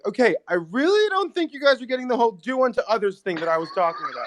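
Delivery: high, patient, exasperated Jesus. Retold or imitated. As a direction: sigh it out like a let-down teacher. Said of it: "and he's like".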